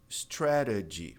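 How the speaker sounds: "Strategy" slowly, middle T as a tapped R; flapped T. Shown as a demonstration. Teacher showing the T can become re, flapped T. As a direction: stretch "Strategy" and tap its second T. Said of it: "pode virar re".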